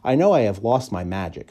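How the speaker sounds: usual speaking voice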